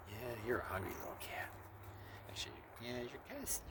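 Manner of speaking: soft whisper to a cat. Real, not acted. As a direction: breathe it gently to the kitten. Murmuring to feeding cat.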